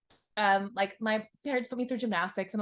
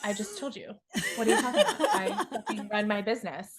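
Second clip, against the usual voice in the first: mock indignant. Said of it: "What do you do for fun?".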